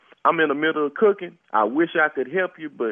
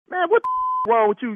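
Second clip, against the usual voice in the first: much higher, cracking with a laugh; exasperated disbelief; 7 semitones higher than usual. Acted. Neighbor exasperated by caller, high disbelieving outburst. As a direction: blurt out in disbelief.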